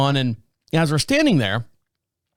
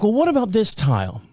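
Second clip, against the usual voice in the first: polite wife; 5 semitones higher, faster than usual. Retold or imitated. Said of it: "She's like".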